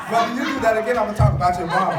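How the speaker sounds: loud, laughing mock warning; mock threat. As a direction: tease him with a hammed-up threat. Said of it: "he looked at me like".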